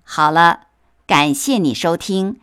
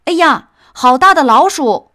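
high, loud cry of alarm; startled cry; 9 semitones higher, 7 dB louder than usual. Acted. Market crowd sees the mouse on the ox, loud high alarmed cry. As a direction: gasp it out at the giant mouse. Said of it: "果然都惊呼起来".

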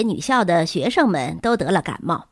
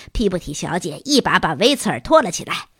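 ordinary delivery

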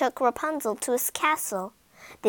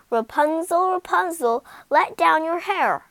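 higher, louder sing-song call; 6 semitones higher, 5 dB louder than usual. Acted. Calling up to Rapunzel to let down her hair, louder. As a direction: call up the tower.

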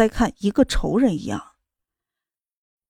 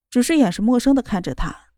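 plain reading voice

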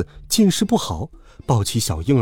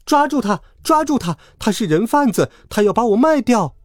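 much higher urgent cry for help; desperate shout; 10 semitones higher than usual. A narrator's reading. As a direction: yell to the crowd to catch her. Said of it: "我不顾一切地喊道".

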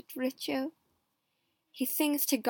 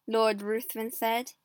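usual speaking voice

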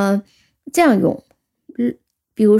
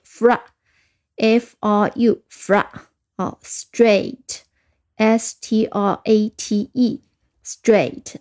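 drawn-out letter by letter spelling; slow spelling; much slower than usual. Shown as a demonstration. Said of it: "我们再拼写一下". (逐个字母慢慢拼读)

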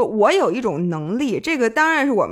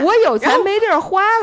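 sarcastic parent, pitched higher and louder; mock sarcastic parent; 9 semitones higher, 4 dB louder than usual. Host mimics a parent's sarcastic complaint, higher. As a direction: sneer it like a fed-up mom. Mocking parents' guilt trip.

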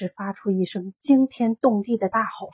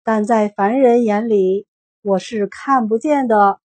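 louder, slow and grand; slow, loud wizard proclamation; slow booming voice; 8 dB louder than usual. A narrator's reading. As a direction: intone it like an unseen god. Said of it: "那声音说".

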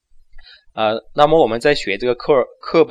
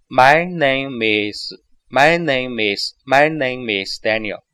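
slow, linked teaching read; slower than usual. Shown as a demonstration. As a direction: stretch each word slowly, keep them joined. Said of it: "我们再放慢一点". (放慢速度，一个词一个词连着读)

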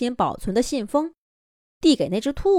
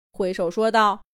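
usual speaking voice

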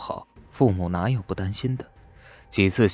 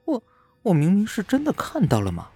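aggrieved; 11 semitones higher than usual. A narrator's reading. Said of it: "又多了点委屈".

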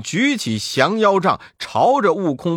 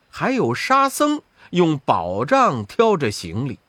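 ordinary delivery

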